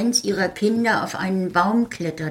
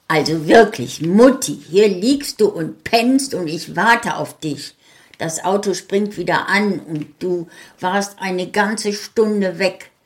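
put-on louder, exasperated young son; scolding son; 3 semitones higher, 6 dB louder than usual. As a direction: tell Mom off for napping. Son scolds sleeping mother.

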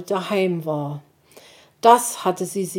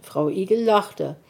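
ordinary delivery, nothing marked

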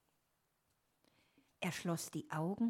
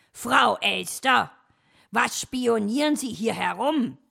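loud, raised, indignant challenge; angry snarl; 5 semitones higher, 12 dB louder than usual. A narrator's reading. Fox catches magpie spying on him, loud angry snarl. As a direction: snap at the eavesdropper. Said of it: "fauchte Herr Fuchs sie an".

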